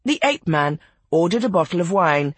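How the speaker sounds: usual speaking voice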